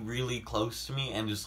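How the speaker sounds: normal talking voice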